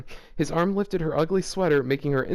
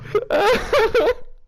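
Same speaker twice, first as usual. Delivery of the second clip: high shrieking laugh; 5 dB louder than usual. Real, not acted. Laughing at the story.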